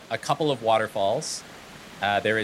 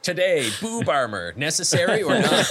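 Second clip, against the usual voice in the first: rising, laughing host pitch; podcast host; 6 semitones higher, 4 dB louder than usual. Pretend. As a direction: tease the topic like a host. Mock podcast topic.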